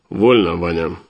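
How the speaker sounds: a hint of a commanding father; lightly voiced old commander; stern father. A narrator's reading. Father's voice, at ease order.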